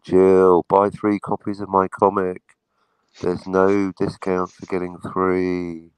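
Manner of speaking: slow, drawn-out subliminal command; slow mock hypnotist coaxing a sleeping fan; hypnotic sleep suggestion. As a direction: lull her into buying, slowly and steadily.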